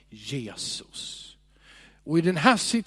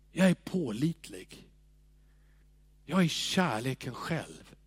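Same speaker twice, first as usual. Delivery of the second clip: soft, slow, quiet preaching voice; hushed devotional; 4 semitones lower, 3 dB quieter than usual. Acted. Preacher speaking softly to the congregation. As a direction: draw them in with a hush. Quiet meditative sermon passage.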